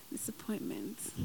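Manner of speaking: soft, low wry repeat; wry murmur. Real, not acted. Preacher repeating the word to herself, soft and wry. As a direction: murmur it to yourself wryly. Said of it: "laughing aside".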